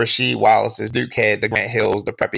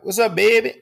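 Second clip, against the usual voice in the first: higher-pitched fake New Orleans greeting; mock New Orleans accent; 8 semitones higher than usual. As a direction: imitate a local faking New Orleans. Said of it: "dude like".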